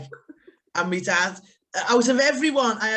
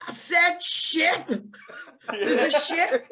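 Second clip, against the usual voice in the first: innocent excuse; 9 semitones higher, 4 dB louder, slower than usual. Retold or imitated. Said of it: "And I was like".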